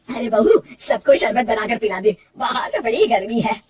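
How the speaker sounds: loud mother-in-law calling orders to her daughter-in-law; an elder ordering the bahu about; bossy mother-in-law. Acted. (सास की हुक्म चलाती आवाज़)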